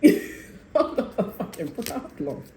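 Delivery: laughing through the line, slowly. Real, not acted. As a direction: say it while cracking up, drawn out.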